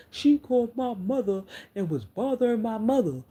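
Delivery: high-pitched indignation; indignant protest. Real, not acted. Woman complaining that her mother was bothered, high indignant protest. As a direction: raise the pitch sharply, setting the record straight.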